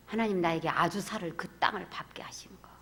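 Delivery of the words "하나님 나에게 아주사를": ordinary talk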